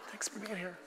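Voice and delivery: very soft, breathy mock of an effeminate young preacher; mock a limp, wispy greeting; hushed, dainty mock welcome. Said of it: "they sound, it's transgender".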